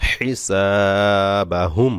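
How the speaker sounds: হিসাবাহুম drawn very slowly, final ম stopped dead; slow হিসাবাহুম, closing on a still ম; pausal form demo. Shown as a demonstration. Teacher shows the stop on হিসাবাহুম, slowly. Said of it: "সাকিন করেই ওয়াকফ করতে হবে".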